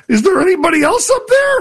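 a man clinging to a branch asking for anyone else; panicked hiker. Acted. Dangling hiker calls to the sky for another helper, frantic high squeak.

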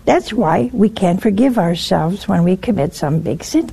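ordinary talk

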